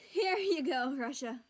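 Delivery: high, loud out-of-breath greeting; panting. A narrator's reading. Kiwi greets Russia after a scuffle, panting.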